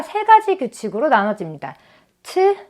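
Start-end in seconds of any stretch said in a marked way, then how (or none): 2.2-2.6 "트" as a high, aspirated t; t ending. Shown as a demonstration. Teacher listing three past-tense endings, aspirated t "트". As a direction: give "트" as a crisp t release. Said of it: "요렇게 끝나는 끝소리가 다른데요".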